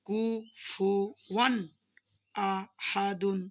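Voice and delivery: কুফুওয়ান আহাদুন drawn out very slowly, each sound clear; slow Quranic recitation. Shown as a demonstration. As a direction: recite it stretched and deliberate, নুন sounded clearly. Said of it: "পুরোটা আপনারা পড়ে দেন এইভাবে পড়বেন". (খুব ধীরে স্পষ্ট করে তিলাওয়াত)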